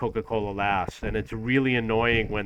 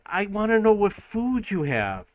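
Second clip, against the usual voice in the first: exasperated; 8 semitones higher than usual. Retold or imitated. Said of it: "And I'm like".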